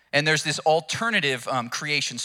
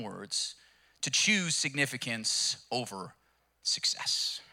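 slow, intimate whispered climax; hushed, softer, for emphasis; 3 semitones lower, 7 dB quieter, slower than usual. Real, not acted. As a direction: lean in and whisper the point.

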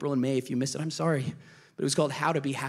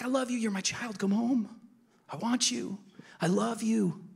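tender father; 7 semitones higher than usual. Pretend. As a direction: call a child home gently, with love. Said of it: "It's like".

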